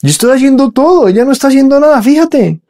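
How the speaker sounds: high, hammed-up whine of a sister keeping score; mock whiny complaint. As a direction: squeal it like a petty tattletale. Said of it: "Está comparando, está llevando cuentas".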